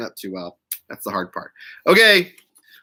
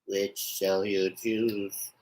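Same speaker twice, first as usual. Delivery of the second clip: ominous low prompt; 7 semitones lower, 12 dB quieter, much slower than usual. Pretend.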